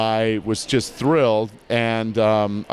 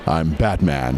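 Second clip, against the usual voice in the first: low heroic read offered to director; deep Batman voice; 7 semitones lower, slower than usual. Shown as a demonstration. Offering the director a low Batman take. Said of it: "No, little higher pitch".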